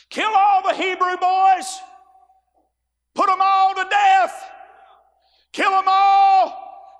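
high, loud, slow roaring command; Pharaoh yelling. Retold or imitated. Preacher voicing Pharaoh ordering the killing, loud yelling. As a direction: bellow it like a raging king. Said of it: "Pharaoh's out there yelling and screaming".

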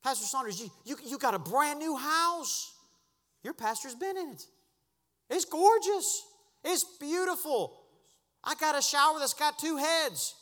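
high, loud boasting about the house; excited rising preach. Acted. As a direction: build excitement over the new house.

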